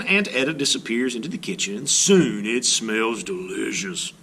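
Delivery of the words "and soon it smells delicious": usual speaking voice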